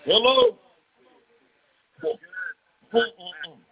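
ordinary talk, nothing marked

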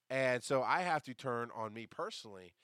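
ordinary talk, nothing marked

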